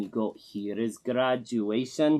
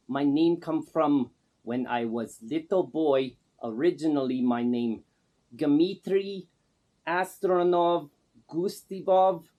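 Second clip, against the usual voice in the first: broken English in a put-on Russian accent; Man Gaga's Russian accent; 3 semitones higher than usual. Man Gaga introduces himself to the interviewer in a put-on Russian accent. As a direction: play a self-styled star with a thick fake Russian accent. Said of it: "The first thing I notice is the accent".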